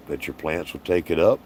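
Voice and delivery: ordinary talk, nothing marked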